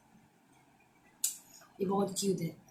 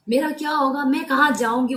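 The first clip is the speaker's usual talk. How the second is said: a loud, raised whine of a stranger asking; funny begging voice; 5 semitones higher, 7 dB louder than usual. Retold or imitated. Retelling the stranger who begged, loud mock whine. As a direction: mimic the pleading stranger, loud and high. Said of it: "वो भी बहुत funny way में वो बोल रहे थे".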